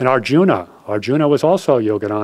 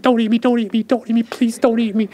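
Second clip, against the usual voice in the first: frightened lamb; 11 semitones higher than usual. A narrator's reading. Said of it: "frozen with fear".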